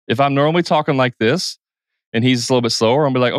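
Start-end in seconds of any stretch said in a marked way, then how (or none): none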